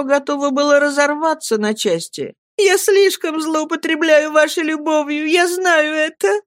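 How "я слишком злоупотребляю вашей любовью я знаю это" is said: put-on a weeping young girl's high voice; with a sob. A narrator's reading. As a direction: confess it through sobs. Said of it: "с рыданием говорила она".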